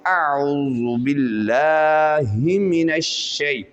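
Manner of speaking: slow chanted recitation; Quranic recitation. Shown as a demonstration. Teacher recites the phrase for students, slow chant. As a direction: recite it slowly with measured length. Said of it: "इसके ख़ास हदूद हैं".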